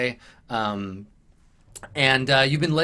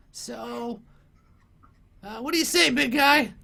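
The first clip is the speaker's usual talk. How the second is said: very high goblin voice, pleading then threatening; squeaky goblin; 3 dB louder than usual. Acted. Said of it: "And he's like".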